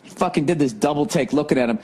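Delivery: usual speaking voice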